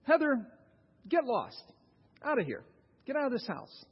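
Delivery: mock rude guest ordering her out. Guest at dinner telling daughter Heather to leave, mock rude. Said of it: "your guest looks at Heather and says".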